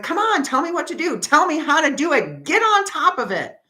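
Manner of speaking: high, loud desperate pleading; begging for answers, at the end of her rope; exasperated plea. Retold or imitated. Pleading with Spirit for answers, high and loud.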